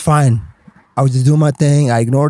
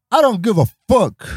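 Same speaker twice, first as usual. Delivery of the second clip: higher-pitched, emphatic dismissal; retold defiance; 7 semitones higher than usual. Newcomer answers Spider-Man's lecture on rules, defiant. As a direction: brush off the warning with defiance.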